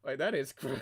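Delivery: normal talking voice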